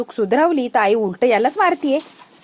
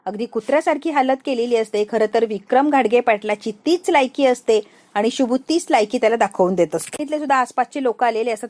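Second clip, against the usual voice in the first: plain reading voice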